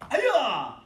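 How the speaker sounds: loud sharp fighting yell; repeated karate yell on command; kiai shout. Shown as a demonstration. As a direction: bark the kiai out hard. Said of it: "So three".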